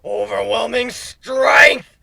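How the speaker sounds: slow, loud booming mock villain proclamation; hammed-up villain rant. A narrator's reading. Griffin fakes embracing the power, hammed-up villain roar. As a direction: shout it like a drunk-on-power overlord.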